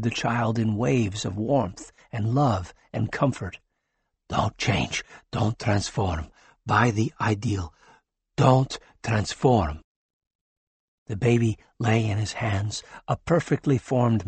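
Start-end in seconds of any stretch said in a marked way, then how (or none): 4.3-6.3 pleading. Acted. Doctor pleads with newborn. 6.7-9.8 pleading. Acted. Doctor pleads with newborn.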